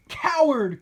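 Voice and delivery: high, loud accusing cry; cried out. A narrator's reading. Captive yelling at the fleeing mayor, loud and high. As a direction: hurl the insult. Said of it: "cried one of the anti-mountainists".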